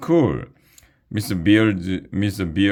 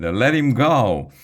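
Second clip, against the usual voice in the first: slow, higher, loud demand; shouted demand; 7 semitones higher, 4 dB louder than usual. Acted. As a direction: order the drone to drop him. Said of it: "shouted Ryan".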